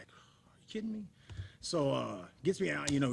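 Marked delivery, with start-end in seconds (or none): 0.0-1.0 hushed, breathy are you kidding me; whispered aside at the cop; whispered disbelief. Retold or imitated. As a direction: mutter it under your breath in shock. Retelling cop stop, own reaction.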